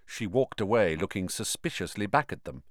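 plain reading voice

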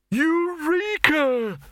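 high, slow, drawn-out cry of discovery; giddy cry of a singed inventor; dazed alchemist. Acted. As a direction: stretch it out high and dreamy, half stunned.

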